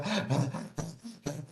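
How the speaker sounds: ragged gasp for air, out of breath; a retold gasp at the pool edge. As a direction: heave in a breath after swimming. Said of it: "J'arrivais plus à respirer".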